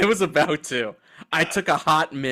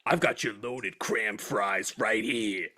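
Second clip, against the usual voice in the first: prison guard character; 4 semitones lower, much slower than usual. Pretend. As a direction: play a menacing guard serving food. Plays leering Turkish prison guard.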